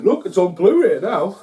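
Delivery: loud, high-pitched excited quote; excited announcement. Retold or imitated. Friend announcing a Blu-ray release to the host, excited. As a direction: burst out with exciting news.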